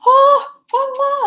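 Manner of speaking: high, loud giddy oh oh oh; excited squeals. Retold or imitated. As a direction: squeal it out with delight. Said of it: "Yeah, I was like".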